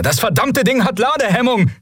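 high, strained shout; urgent, high-pitched call. A narrator's reading. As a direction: yell it over gunfire. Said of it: "rief er".

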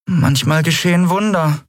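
high, small creature voice; piping little creature; little Nelen. A narrator's reading. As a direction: offer it in a tiny high pitch. Nelen's dialogue in narrated story.

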